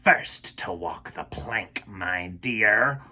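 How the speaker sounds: hissed villain threat; Captain Hook whispers his threat to Wendy; menacing whisper. Acted. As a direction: whisper it like a gloating pirate captain.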